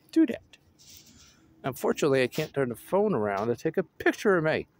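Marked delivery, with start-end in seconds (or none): 0.1-0.6 short high echo; high-pitched repeat. Pretend. Repeating own wish to do that, high voice. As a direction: say it briefly, way up high.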